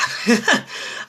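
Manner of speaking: a high airy giggle; high breathy laugh. Real, not acted. Laughing at own joke.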